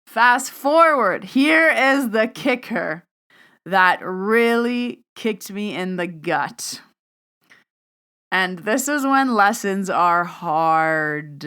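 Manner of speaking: slow, loud, drawn-out lead-in to the twist; dramatic buildup. Real, not acted. To her listeners, building suspense slowly and loudly. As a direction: lean into each word to build suspense.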